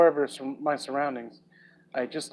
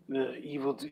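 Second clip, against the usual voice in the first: movie trailer voice; 3 semitones lower, 12 dB quieter than usual. Pretend. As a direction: tease it like a horror film trailer. Mock movie trailer read.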